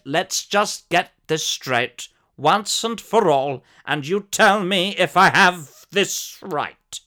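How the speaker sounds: slow, firm demand to the cashier; slow and deliberate. Pretend. Pinning down the deal slowly.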